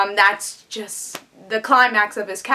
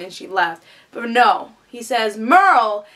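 ordinary talk, nothing marked

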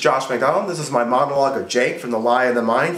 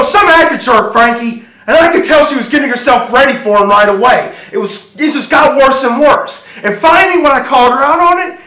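loud, high-pitched shouted tirade; furious rant; 12 semitones higher, 8 dB louder than usual. Acted. Jealous husband accusing his wife of lying, furious shouting. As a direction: rage at full volume, barely stopping.

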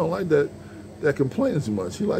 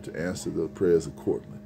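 normal talking voice

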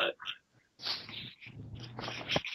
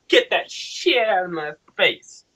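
high, drawn-out outraged refusal; hammed-up indignant send-off, stretching the words; mock indignation; 7 semitones higher, slower than usual. Rejecting the friend's offer of weed, mock indignation.

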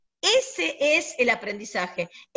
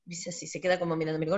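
usual speaking voice

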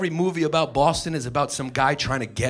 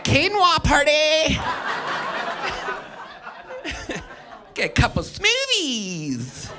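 high, slow and airy hipster drawl; mock hipster; 9 semitones higher, much slower than usual. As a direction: play the trendy quinoa crowd, higher and slower. Mocking trendy New Yorkers.

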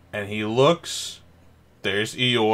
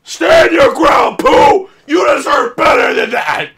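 shouting cheer; 13 semitones higher, 8 dB louder than usual. Real, not acted. Cheering Pooh on screen.

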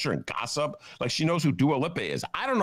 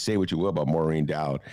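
ordinary delivery

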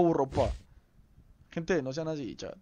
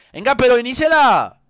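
high, loud demand; exigencia a gritos; 7 semitones higher, 10 dB louder than usual. Real, not acted. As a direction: shout at them to get it started. Exige que empiecen la sala. (reclamo gritado y agudo)

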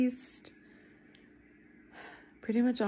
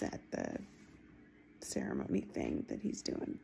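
trailing whisper; 12 dB quieter than usual. Real, not acted.

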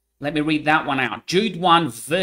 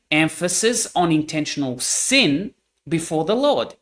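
ordinary talk, nothing marked

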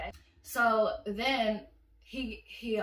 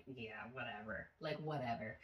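dismissive; 11 semitones lower, 14 dB quieter than usual. Retold or imitated. Dismissing him after no kiss.

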